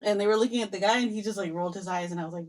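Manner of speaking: ordinary talk, nothing marked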